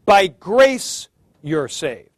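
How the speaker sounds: "grace" hit loud, then "saved" dropping away; stress on grace. Shown as a demonstration. Teacher showing students what comes first, emphatic "grace". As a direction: punch "grace" and let the rest fall. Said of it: "in an emphatic position".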